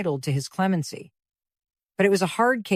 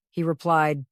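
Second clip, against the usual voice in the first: ordinary delivery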